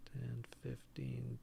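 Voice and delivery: very soft, slow whispered count; counting under breath. Real, not acted. Game master counting out an enemy's movement, under breath. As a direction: count slowly to yourself, barely voiced.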